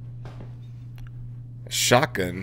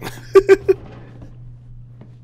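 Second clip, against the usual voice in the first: high-pitched burst of giggling; high laugh; 4 dB louder than usual. Real, not acted. Laughs after his herb riff.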